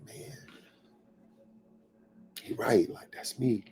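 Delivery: very soft, inward admission; hushed self-recognition. Acted. Listener hearing hard truth about themselves, quietly conceding. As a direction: murmur it as a private thought. Acting out listener's private realisation.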